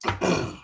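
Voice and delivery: short throat clear. Real, not acted. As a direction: clear the throat before speaking. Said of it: "Clear your throat for the interview".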